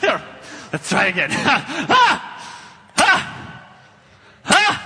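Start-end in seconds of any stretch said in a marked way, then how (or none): 1.9-4.7 overdone fight screams; hammed-up screams. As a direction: scream like a cartoon villain losing. Fight cries again.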